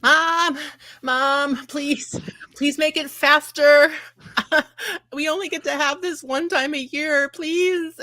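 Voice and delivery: put-on high, pleading little kid voice; whiny child. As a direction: beg like an impatient child tugging an apron. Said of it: "a small child pulling at her apron strings, going".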